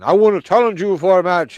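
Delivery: a botched Andre the Giant with an English lilt; a failed impression of a giant wrestler; bad Andre the Giant. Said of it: "I did not sound like Andre the Giant".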